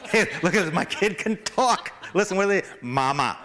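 high, laughing, proud parent showing off; giddy parent showing off the baby; a parent calling friends over to hear the baby, high and laughing; excited proud parent. Pretend. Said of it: "You drag him over here".

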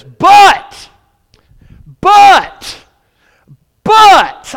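very high, explosive single-word shouts; emphatic shout. Real, not acted. Preacher calls the church to look at this, shouting. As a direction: punch each one out loud, with a pause between.

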